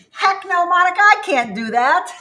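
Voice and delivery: high, whiny mock protest from a tired viewer. Imitating a viewer who refuses the move, high and whiny. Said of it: "Some of you are like".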